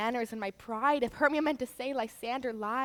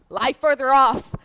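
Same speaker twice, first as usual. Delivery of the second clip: firm command; 10 dB louder than usual. Acted. Hermia pushing Lysander away.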